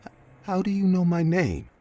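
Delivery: high, stammering frightened question; scared voice. Retold or imitated. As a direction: stutter it out in fear. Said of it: "I asked in a scared voice".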